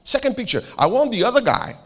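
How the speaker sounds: put-on high-pitched hammy Norwegian accent; bad Norwegian accent. Norwegian manager orders second picture, bad Norwegian accent. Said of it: "I'm doing a very bad accent".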